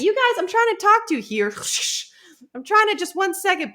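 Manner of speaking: high, put-upon plea; hammed-up annoyed politeness; mock polite exasperation. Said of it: "she's like".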